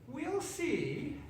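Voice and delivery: high sly witch, a touch of laughter; mock knowing laugh. A narrator's reading. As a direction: chuckle it to yourself. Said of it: "laughing to herself".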